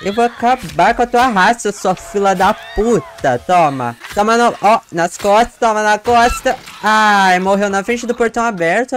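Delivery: loud high gloating threats; savage taunting. Real, not acted. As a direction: gloat while hacking away. Attacking survivor, threats.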